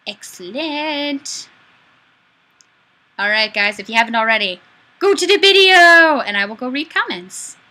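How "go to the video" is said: loud, high call to viewers. Real, not acted. Streamer loudly urging viewers to go to the video. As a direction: shout it out to the audience.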